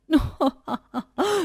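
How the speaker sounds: high taunting laugh; jeering laugh from the neighbour's house; mocking cackle. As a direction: cackle at her rival's misfortune. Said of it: "हंसी उड़ाती है".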